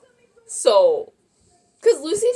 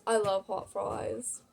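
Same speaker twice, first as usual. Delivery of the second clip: slow, softer British accent; 2 semitones lower, 10 dB quieter, slower than usual. Real, not acted. Naming a favorite snack, accidentally in a British accent. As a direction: slip into a British accent, drawn out. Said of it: "I just turned British".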